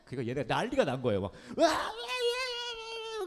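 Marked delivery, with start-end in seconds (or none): 1.3-3.2 high, stretched, laughing shrieks; mock wild screaming. Pastor acting out the kids going wild at the camp. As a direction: scream like a room of hyped kids.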